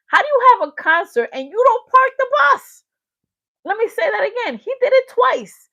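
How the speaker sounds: high, loud, incredulous complaint; exasperated outburst. Real, not acted. Vlogger demanding why he won't park the bus, exasperated.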